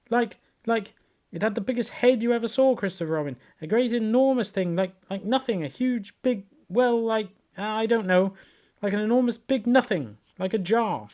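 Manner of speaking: high, fumbling, frightened babble; flustered panic. A narrator's reading. Piglet telling Christopher Robin, high and flustered. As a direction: grasp for words in a scared rush. Piglet frantically describing the monster.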